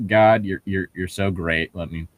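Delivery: ordinary talk, nothing marked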